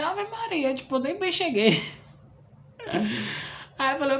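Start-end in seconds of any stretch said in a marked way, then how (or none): 0.0-0.7 high, stunned Ave Maria; shocked exclamation. Retold or imitated. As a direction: gasp it out in disbelief.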